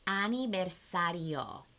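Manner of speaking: said slowly and softly for repeating. Shown as a demonstration. Teacher modelling the word slowly for viewers. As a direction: stretch aniversario out syllable by syllable. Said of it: "let's do that a little bit slower".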